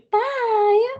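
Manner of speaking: very high, drawn-out goodbye; put-on high farewell; mock cheery bye. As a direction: sing out an exaggerated bye. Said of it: "that has never been you".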